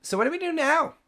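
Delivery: very high put-on voice; piping familiar voice. Familiar companion pipes up behind the party, very high voice. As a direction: pipe up in a tiny, high voice. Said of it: "very familiar voice pipe up and go".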